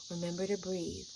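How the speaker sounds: soft, calm breathing guide; quiet coaxing voice; gentle guiding voice. Retold or imitated. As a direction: soothe her into breathing. Guiding her through breathing.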